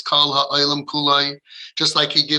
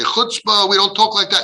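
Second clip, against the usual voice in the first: indignant rebuke; 5 semitones higher than usual. Acted. Acted parent shutting child down.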